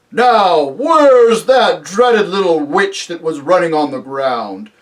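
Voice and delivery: high, loud, drawn-out giant voice; booming giant. Acted. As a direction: bellow slowly like a giant just woken. Waking giant speaks in story.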